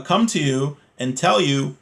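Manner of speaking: normal talking voice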